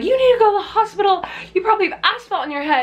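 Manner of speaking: high, rapid imitation of fussing old ladies. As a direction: rush it out in mock alarm. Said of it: "These old ladies".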